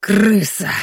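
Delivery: put-on loud, slurred drunken shout. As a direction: bark it out like a drunk thug. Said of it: "пьяным голосом воскликнул Бурый".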